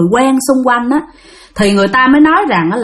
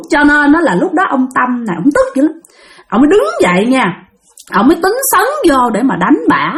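ordinary delivery, nothing marked

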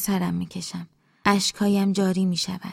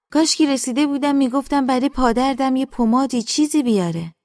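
put-on raised, plaintive older woman's grumble; complaining mother; 6 semitones higher, 5 dB louder than usual. Mother laments her sore leg, high and complaining. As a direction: grumble in a higher voice, dropping at the end.

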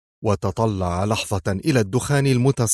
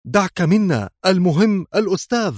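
high-pitched insistent exclamation; impatient, emphatic outcry; urgent cry; 9 semitones higher than usual. A narrator's reading. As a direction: brush it off with a high, urgent call. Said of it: "هتفت به ريهام".

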